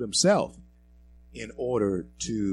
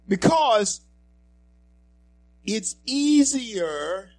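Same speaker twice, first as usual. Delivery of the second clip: alarmed cry; 13 semitones higher, 8 dB louder, much slower than usual. Real, not acted. Rising alarm to audience.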